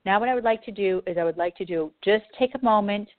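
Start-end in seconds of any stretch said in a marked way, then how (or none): none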